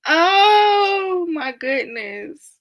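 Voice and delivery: laughing exclamation. Real, not acted. Cracking up at chat.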